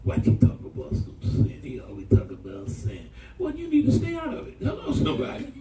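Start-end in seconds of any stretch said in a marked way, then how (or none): none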